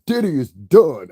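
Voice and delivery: high, loud, slow gloating; mocking YouTuber intro. Mocking YouTubers' openers, loud and high. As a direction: announce it like a hyped gossip host. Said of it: "All of them start this shit".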